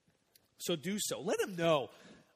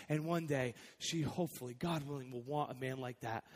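ordinary delivery

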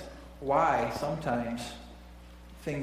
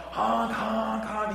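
imitated horn honks; 7 semitones higher, much slower than usual.

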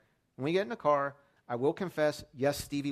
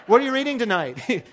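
put-on high, loud, laughing kid's question; amused imitation of an eager son; laughing child voice; 9 semitones higher, 12 dB louder than usual. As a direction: mimic the boy through a laugh. Son asks at bedtime.

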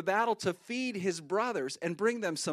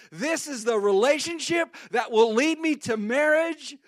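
high, loud, eager voice; hammed-up excitement, higher and louder; giddy hopeful; 6 semitones higher, 6 dB louder than usual. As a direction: gush about the perfect boyfriend. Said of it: "Have you ever felt like".

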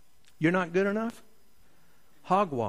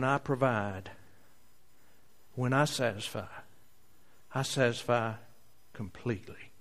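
gentle Jesus; 7 dB quieter than usual. Acted. Jesus voiced softly to disciples.

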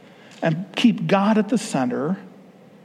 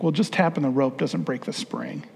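gentle apology; 4 semitones lower, 5 dB quieter than usual. Retold or imitated. Dad apologizing to son.

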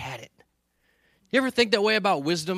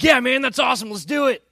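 higher, louder gung-ho reply; eager agreement; 6 semitones higher, 6 dB louder than usual. Retold or imitated. Retelling his answer to buddies' hunt invite, eagerly. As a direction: jump at the invitation.

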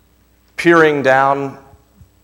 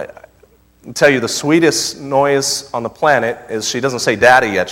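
ordinary delivery

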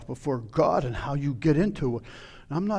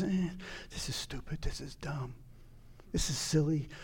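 soft mumbled scoff, hardly bothering; lax, dismissive; 9 dB quieter than usual. Acted. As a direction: grumble it under the breath, half-hearted. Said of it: "It was his lax".

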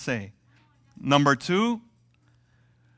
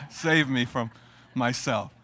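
ordinary talk

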